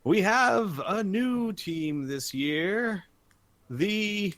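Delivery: high, drawn-out announcer build-up; arena announcer. Acted. Announcer introducing a new team to the arena, grand showman. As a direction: stretch it out and leave it hanging. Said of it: "He says".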